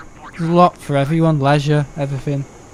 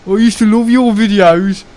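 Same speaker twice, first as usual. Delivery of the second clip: high, loud Brummie viewer voice; hammed-up Birmingham accent, raised in pitch; mock Birmingham accent; 6 semitones higher, 5 dB louder than usual. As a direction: imitate an indignant Brummie commenter. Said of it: "gonna get a nasty comment from someone saying".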